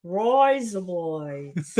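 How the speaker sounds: slow broad Australian rise up lights, rise said like roise. Shown as a demonstration. Australian shows how she says razor blades. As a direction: stretch rise up lights as roise up loights, slowly. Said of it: "it sounds like you're saying rise up lights".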